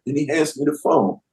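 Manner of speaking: ordinary talk, nothing marked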